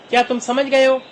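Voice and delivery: usual speaking voice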